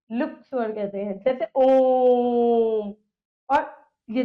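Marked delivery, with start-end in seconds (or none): 1.6-2.9 ओम held very long on a level note; triple-length vowel. Shown as a demonstration. Teacher showing a triple-length vowel, hugely prolonged. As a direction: stretch the vowel of ओम to three times its length. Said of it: "तीन गुना समय लगता है".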